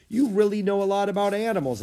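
put-on quick high boyish voice. A narrator's reading. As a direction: admire her quickly. Jack admiring Annie.

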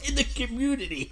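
high, loud laughing echo. Real, not acted. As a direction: repeat it through helpless laughter. Laughing at friend's phrase.

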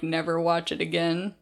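ordinary talk

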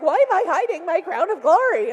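hammed-up indignation; playful outcry; mock exclamation. Mock self-question, laughing.

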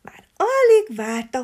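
plain reading voice

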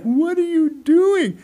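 high, slow, drawn-out mock question; loud high-pitched retold disbelief; imitating helpless laughter. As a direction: squeal it out like someone cracking up. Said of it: "He's laughing so hard".

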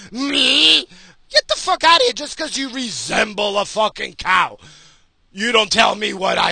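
loud, high, furious comeback; angry shout. Real, not acted. Ranting at the vegan pig, furious shout. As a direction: yell it at the vegan who lectured you.

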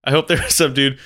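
ordinary delivery

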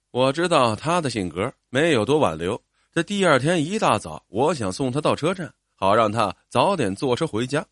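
usual speaking voice

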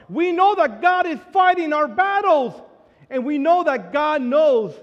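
preacher rising to a shout of faith; full-force preaching. Acted. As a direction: build the congregation up, hammering every line.

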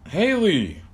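high call across the house. Acted.

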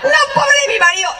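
high laughing exclamation; laughing outcry. Real, not acted. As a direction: cry out in laughter. Laughing shout about her husband.

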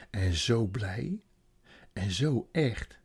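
very slow, stretched zo; slow wonder; savoured. A narrator's reading. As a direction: linger on each zo. Narrator savours the moment.

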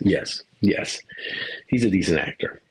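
ordinary delivery

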